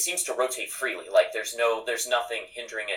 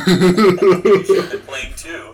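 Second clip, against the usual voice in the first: laughing; 8 semitones higher, 12 dB louder, slower than usual. Real, not acted. Teasing a player with a laugh.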